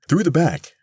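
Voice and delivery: high, loud command to the crowd; rallying cry. A narrator's reading. Missus Bellows leads the women backstage, crying out. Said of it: "cried Missus Bellows, feeling like Madame Lafarge".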